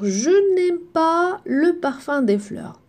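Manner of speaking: slow correct "n'aime", each word clear; slow model. Shown as a demonstration. Teacher modelling the correct negation for pupils, slowly. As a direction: say it slowly, the right way.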